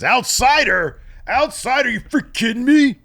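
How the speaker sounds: high, loud outraged promo voice; incredulous wrestling promo shout; mock angry Randy Orton. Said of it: "Randy Orton pissed off".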